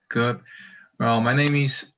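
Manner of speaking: normal talking voice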